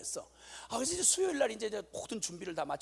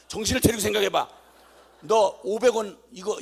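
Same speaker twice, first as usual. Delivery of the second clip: stern lecture; 10 dB louder than usual. Retold or imitated. Father lecturing daughters.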